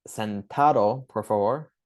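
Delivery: slow, clear "Sentado, por favor"; slow Spanish command. Shown as a demonstration. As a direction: draw out "Sentado" to a patient. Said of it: "next I'll say".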